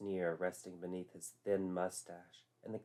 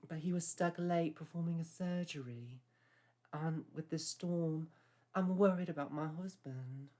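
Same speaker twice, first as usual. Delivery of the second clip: put-on high, genteel old English lady; posh older lady; 8 semitones higher, 4 dB quieter than usual. A narrator's reading. Babcock voiced in story.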